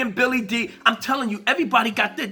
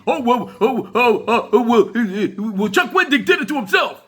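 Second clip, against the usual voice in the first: mock stammering excuse. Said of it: "you go on the three sixty".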